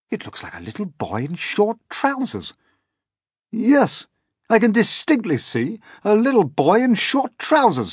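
high, loud, stammering disbelief; captain can't believe his eyes; astonished captain. A narrator's reading. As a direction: stumble over what the telescope shows.

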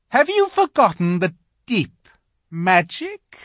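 high, cold witch voice. Acted. Witch voiced in read story.

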